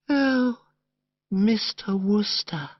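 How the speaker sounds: high, slow, melting "Oh, Mister Wooster"; drawn out as if overcome; swooning girlish sigh. Acted. Madeline melting as Bertie woos her, slow and high. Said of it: "from the tone of her voice".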